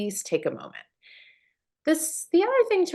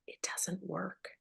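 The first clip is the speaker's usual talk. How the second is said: whispered aside; 2 semitones lower, 15 dB quieter, slower than usual. Real, not acted. Host confiding to parents, whispered aside. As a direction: whisper it, slow and conspiratorial.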